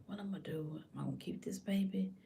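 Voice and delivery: hushed, acted self-questioning; asking herself. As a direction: murmur the questions privately. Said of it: "if she asks herself".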